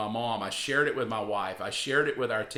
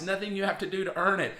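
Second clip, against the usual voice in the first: ordinary talk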